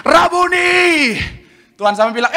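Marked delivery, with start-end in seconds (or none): 0.0-1.2 high, loud, drawn-out shout; acted cry of recognition; joyful cry. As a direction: cry it out, stretching the word. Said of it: "langsung dia teriak".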